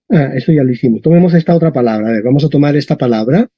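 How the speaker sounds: ordinary talk, nothing marked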